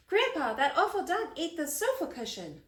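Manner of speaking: put-on high whiny kid voice; lightly voiced complaining child. A narrator's reading. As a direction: tattle to grandpa.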